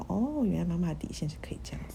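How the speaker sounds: hushed, to herself; whispered thought. Retold or imitated. Daughter realizing mom's limits can move, whispered.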